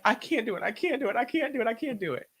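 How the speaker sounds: high, rapid-fire protest; desperate pleading. Retold or imitated. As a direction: plead helplessly, over and over. Acts out pleading with God.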